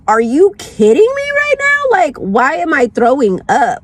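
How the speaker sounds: squealing outrage at a high pitch; high disbelief. Retold or imitated. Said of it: "I was like".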